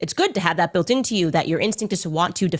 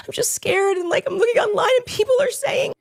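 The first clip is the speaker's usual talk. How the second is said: high, whiny mock distress, pitched way up; mocking Erica. Mocking Erica pretending to be scared to the Vice President. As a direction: send up a fake-scared damsel. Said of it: "She's like".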